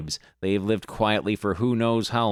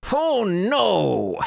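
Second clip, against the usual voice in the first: much higher, louder cry of alarm; real dismay; 11 semitones higher, 4 dB louder than usual. Real, not acted. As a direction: yelp it as if caught off guard. Player reacts to game event.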